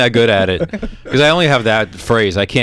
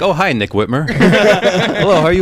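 mock-pleasant host, half laughing; polite greeting voice; 6 semitones higher than usual. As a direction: switch to an overly polite hello. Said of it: "but when they get here".

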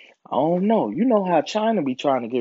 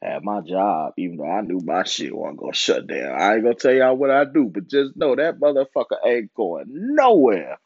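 ordinary talk

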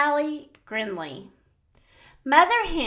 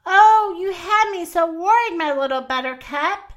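tender relief; 6 semitones higher, 4 dB louder than usual. A narrator's reading. Mother Hen greets found chick.

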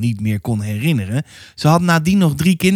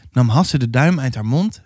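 usual speaking voice

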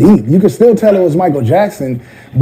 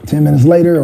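usual speaking voice

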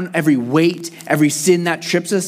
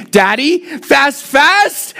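much higher, slow, climbing "Daddy, fast, fast"; toddler begging; 7 semitones higher, much slower than usual. Retold or imitated. Toddler son begs daddy at dinner, high. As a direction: pitch it up like a two-year-old. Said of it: "And then you say".

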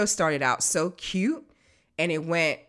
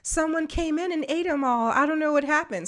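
put-on high little-girl voice insisting; imitating the toddler again; 12 semitones higher than usual. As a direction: play the child denying it. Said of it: "she was like".

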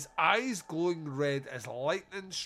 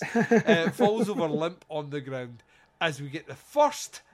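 giggling restart of the line; laughing; 8 dB louder than usual. Shown as a demonstration. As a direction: reread through laughter, louder. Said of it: "I clearly didn't wank".

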